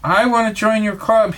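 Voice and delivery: plain reading voice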